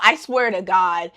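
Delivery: ordinary talk, nothing marked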